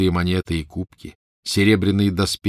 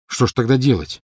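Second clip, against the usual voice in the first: angry question; 5 semitones higher, 4 dB louder than usual. A narrator's reading. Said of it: "сердито спросил Гарри".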